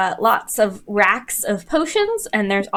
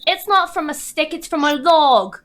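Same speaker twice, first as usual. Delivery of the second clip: put-on know-it-all kid; 7 semitones higher, 3 dB louder than usual. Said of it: "the older one looks at you and says".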